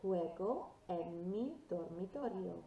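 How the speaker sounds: slow, loud "Juego en mi dormitorio", J said as H. Shown as a demonstration. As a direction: model "Juego en mi dormitorio" slowly with a breath H starting "Juego". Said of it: "always sounds like an H".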